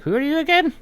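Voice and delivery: high, loud, baffled question; confused old gnome. Acted. Forgetful old gnome asking the stranger, high and loud. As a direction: ask like you've forgotten everything. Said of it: "he's like".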